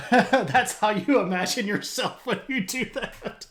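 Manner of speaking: high, loud words through laughter; laughing hard. Real, not acted. Streamer reading a teasing chat comment, laughing hard. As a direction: talk while cracking up.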